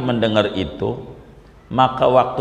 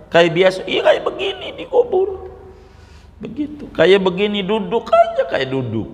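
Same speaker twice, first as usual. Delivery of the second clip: amused realization; 9 semitones higher than usual. Acted. Umar's amused realization, voiced.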